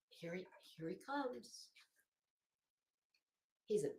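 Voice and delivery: usual speaking voice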